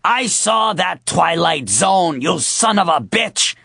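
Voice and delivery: put-on old lady furious shout; triumphant yell. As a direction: spit it at the con man. Old lady sprays attacker.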